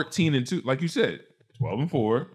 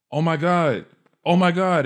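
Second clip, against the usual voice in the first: higher, louder mock dismay; dramatic retelling; 8 semitones higher, 5 dB louder than usual. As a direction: act out her dramatic "oh my God". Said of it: "What's she being so dramatic about?".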